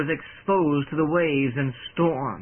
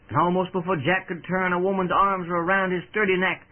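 plain reading voice

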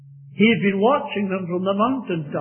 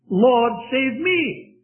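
slow plea; 6 semitones higher, much slower than usual. Acted. Preacher stresses the plea.

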